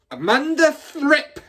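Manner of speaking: furious shout. Acted. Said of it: "she was shouting".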